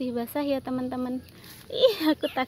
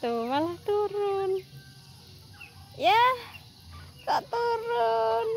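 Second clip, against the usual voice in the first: higher cooing, slow on turun; cooing baby talk; 8 semitones higher, slower than usual. Acted. Gushing at her squirrels who won't climb, higher slow cooing. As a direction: melt over how cute they are.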